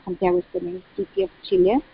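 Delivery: ordinary talk, nothing marked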